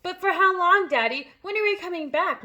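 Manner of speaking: loud, high desperate question; cried. A narrator's reading. Matilda asks her father when they return, loud and desperate. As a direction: plead to know. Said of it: "Matilda cried".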